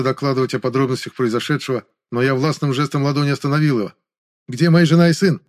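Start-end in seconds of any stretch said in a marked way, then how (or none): 4.5-5.4 громко и выше, требовательно; требуй ответа о своей семье; встревоженный вопрос. A narrator's reading. (встревоженно, требовательно)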